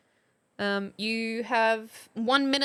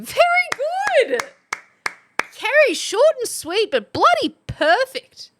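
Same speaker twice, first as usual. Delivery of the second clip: high, loud burst of delighted praise; excited praise; 12 semitones higher, 7 dB louder than usual. Real, not acted. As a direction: cheer the winner on, voice soaring. Host cheers a winning entry.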